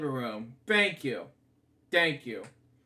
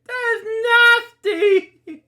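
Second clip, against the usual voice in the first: loud, high, drawn-out outcry of disgust; high slow yell of real disgust; disgusted outburst; 10 dB louder, much slower than usual. Real, not acted. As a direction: shriek out that it's gross. Disgusted reaction to the prank.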